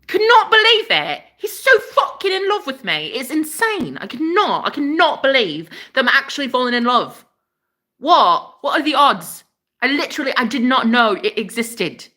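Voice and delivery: high-pitched, gushing amazement; giddy disbelief. Real, not acted. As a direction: gush like you can't believe your luck. Gushing about being in love.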